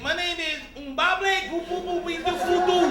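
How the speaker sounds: high, slow put-on African accent with babbled name; mock African accent. Captive hiding that he is American from captors, mock African accent. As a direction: fake an African accent to pass as non-American. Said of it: "I'll be like".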